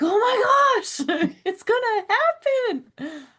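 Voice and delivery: laughing excitement. Retold or imitated. Fan thrilled at preview.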